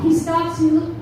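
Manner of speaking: usual speaking voice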